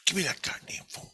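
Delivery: hushed, urgent whisper; whispered demand. Retold or imitated. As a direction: daughter whispering to dad to hand over the phone. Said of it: "She goes".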